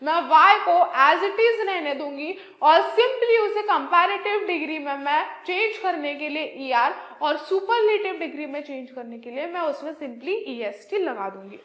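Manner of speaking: ordinary talk, nothing marked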